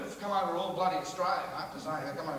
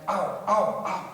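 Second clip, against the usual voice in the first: acted pain; 7 dB louder, much slower than usual. Acting pain from thongs biting in.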